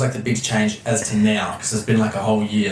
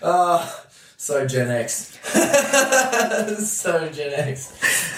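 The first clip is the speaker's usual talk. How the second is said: high bursts of laughter, slow words through them; laughing; 10 semitones higher, much slower than usual. Real, not acted. Host laughing at her dad's "trust no one" advice.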